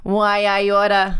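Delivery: put-on slow, old-time New York gangster; mobster murderer voice. Explaining her accent to the co-host, slow mobster voice. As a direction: play a hammed-up forties mobster. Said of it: "my mobster murderer voice".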